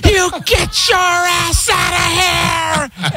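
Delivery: hammed-up furious dad yell; retold furious yell. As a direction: blow a gasket at the dog. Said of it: "he just goes".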